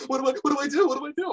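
high, panicked mock whine; a hammed-up frantic customer voice. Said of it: "it's so mad".

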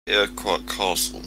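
ordinary delivery

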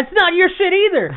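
high, heated protest at mom; indignant yelling, high-pitched; retold angry outburst. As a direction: snap back at mom in disbelief.

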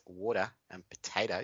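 "water and potatoes" with imitation Aussie-Kiwi vowels; antipodean accent. Shown as a demonstration. Showing the only words he can do, Australian accent. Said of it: "the only thing that I can say".

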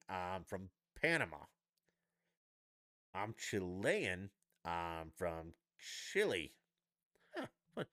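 normal talking voice

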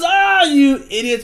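high, loud, drawn-out imitated cry; mock anguished cry. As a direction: stretch it out as the falling mentor. Acts falling mentor crying out.

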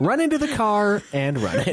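giggling while chanting; laughing. Real, not acted. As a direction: laugh through the words. Egging on, laughing.

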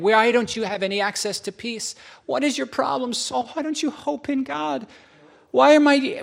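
exasperated psalmist. Acted. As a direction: scold your own soul, voice climbing. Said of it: "Or Psalm forty-two and forty-three".

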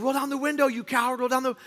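high, imitated yelling; retold yelling. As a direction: repeat his yelled order. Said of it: "he keeps yelling".

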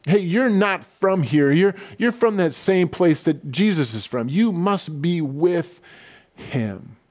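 a lightly voiced suspicious bystander calling him out; accusing bystander. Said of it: "people were calling out his accent".

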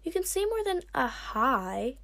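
high, loud prodding; exaggerated high, loud coaxing; shouted. A narrator's reading. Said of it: "Kinkajou shouted at the sea wing".

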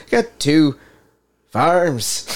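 lyrical lilt on the last word, pausing before it; awkward pause, then try hard on the ending; lyrical Christopher Walken. Retold or imitated. Host mocks an actor's poor Irish accent, lyrical impression. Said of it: "he gets a little lyrical with it on the end".

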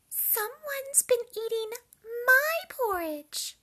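a lofty indignant mama bear. A narrator's reading. Mama Bear noticing her porridge, high loud indignation. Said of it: "said Mama Bear".

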